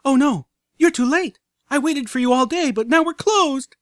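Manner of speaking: high, loud hammed-up dismay; overacted filly panic; mock horror. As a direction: wail it like a tragedy. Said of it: "gasping as if in horror".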